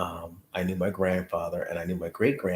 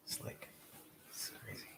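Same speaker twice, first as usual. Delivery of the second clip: whisper; 25 dB quieter than usual. Real, not acted. Whispering after closing the door.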